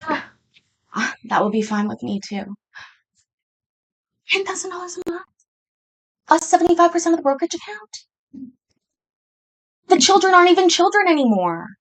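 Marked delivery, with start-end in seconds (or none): 4.3-11.7 high, fast disbelief; can't believe the number; incredulous. Real, not acted. Incredulous at the demand.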